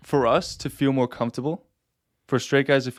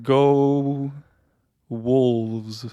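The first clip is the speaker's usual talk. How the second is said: unearthly voice. Shown as a demonstration. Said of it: "That's my cheer".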